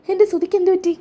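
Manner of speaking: high-pitched anxious cry of a mother; worried mother, pitched well up; alarmed mother. Acted. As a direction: blurt it out in acted alarm. Mother hears of son's accident.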